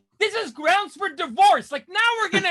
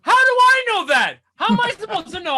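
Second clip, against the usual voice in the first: higher, louder exasperated cry; indignant outburst; 4 semitones higher, 6 dB louder than usual. Acted. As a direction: demand it, fed up.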